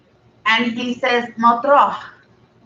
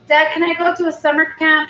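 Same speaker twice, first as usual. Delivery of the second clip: put-on higher, fast, begging kid voice; pleading little girl; 6 semitones higher, faster than usual. Daughter begging her dad to go to summer camp, pleading. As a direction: wheedle like a child who wants something.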